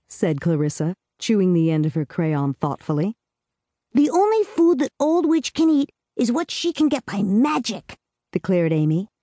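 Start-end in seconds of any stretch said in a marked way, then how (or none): 3.9-8.0 put-on higher, earnest little girl voice; a small girl laying down the rules; little girl Amy. A narrator's reading. Amy telling Clarissa the witch's fate, in a higher girlish voice.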